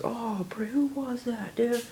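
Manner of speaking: high, drawn-out "oh bro" wail; mock outcry. Everyone reacting to a flub, mock outcry. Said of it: "everyone's gonna be like".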